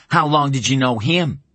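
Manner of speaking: challenging. Acted. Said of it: "Tom challenged quietly, triumphantly".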